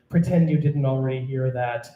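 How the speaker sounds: ordinary talk, nothing marked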